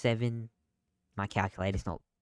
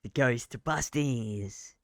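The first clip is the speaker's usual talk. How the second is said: dramatic; 2 semitones higher, much slower than usual. Pretend. Dramatic slow answer.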